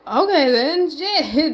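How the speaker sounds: stunned, loud and high; shocked. Real, not acted. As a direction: caught off guard by the news. Reacting to a shocking tweet.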